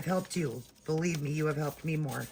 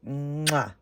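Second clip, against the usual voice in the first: kiss sound. Real, not acted. Host blows viewers a smacking kiss to close the video. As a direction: blow a kiss goodbye.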